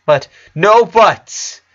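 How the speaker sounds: high, loud, slow interruption; cutting in. A narrator's reading. Lincoln cutting off his sister mid-word, sharp. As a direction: shut down her protest hard. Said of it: "he interrupted Luann".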